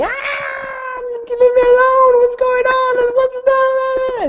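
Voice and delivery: terrified screaming. Acted. GM voicing the girl dragged back from other realms, screaming in terror. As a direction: scream it out like a lost girl torn from another world. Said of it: "she starts screaming".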